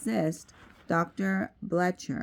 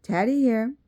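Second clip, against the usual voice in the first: a cheerful phone greeting, higher and brighter; 7 semitones higher, 5 dB louder than usual. A narrator's reading. Teddy greeting the caller over the phone, cheerful. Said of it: "a cheerful voice answered".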